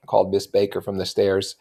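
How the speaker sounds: usual speaking voice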